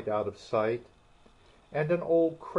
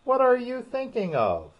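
high, sharp demand; severe; 12 semitones higher, 5 dB louder than usual. Acted. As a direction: snap the question at her. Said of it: "said the mouse to Alice severely".

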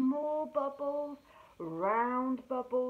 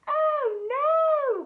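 much higher, louder cry of alarm; panicked cry; 12 dB louder than usual. Acted. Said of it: "cried the fish".

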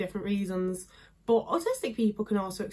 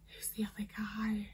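whispered question to oneself; muttered aside; 11 dB quieter than usual. Real, not acted. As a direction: whisper it under your breath, searching for a name. Asks herself while searching for name.

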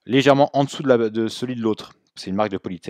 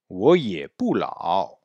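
我也不老 syllable by syllable, full tones; drawn-out Mandarin drill; slow repeat model; much slower than usual. Shown as a demonstration. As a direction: say it slowly for students to copy. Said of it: "Répétez".

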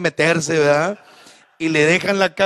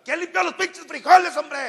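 much higher mock yell at the table; father yelling; 11 semitones higher, 3 dB quieter than usual. Father yells at family over stony beans. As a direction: shriek it like a fed-up dad. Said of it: "el señor grita".

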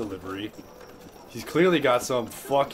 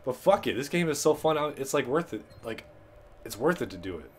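normal talking voice

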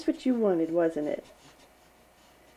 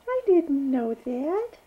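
higher, louder playful mock surprise; 6 semitones higher, 4 dB louder, much slower than usual. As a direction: pretend to be amazed for the baby. Repeated line to baby.

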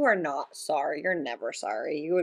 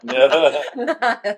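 loud laugh; 8 semitones higher, 9 dB louder than usual. Real, not acted. Player cracks up at joke.

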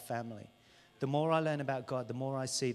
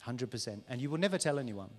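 normal talking voice

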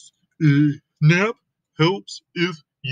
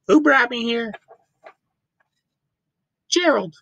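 squeaky high piglet; a puzzled little pig voice; high Piggy voice; 10 semitones higher, faster than usual. Acted. Piggy waking up and asking Gerald, high and puzzled.

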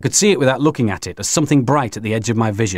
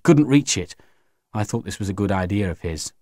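plain reading voice

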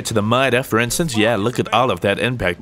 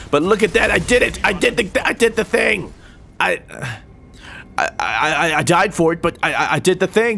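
excited; 6 semitones higher than usual. Real, not acted. Excited after scoring.

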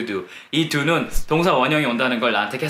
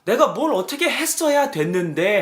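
frustrated; 6 semitones higher than usual. Shown as a demonstration. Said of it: "하면".

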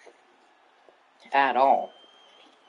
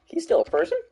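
much higher, loud disbelief; surprised; 8 semitones higher, 6 dB louder than usual. Real, not acted. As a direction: gasp it out in disbelief, laughing. Amazed a fighter still exists.

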